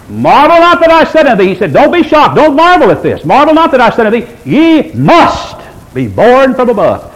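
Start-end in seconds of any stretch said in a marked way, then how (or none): 0.1-1.5 high, forceful scripture quote; emphatic. Retold or imitated. Jesus speaking to Nicodemus, emphatic. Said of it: "Jesus emphatically said to Nicodemus". 1.7-7.1 insistent, preacherly quote of Jesus; emphatic. Retold or imitated. Jesus urging Nicodemus not to marvel, emphatic. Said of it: "Jesus emphatically said to Nicodemus".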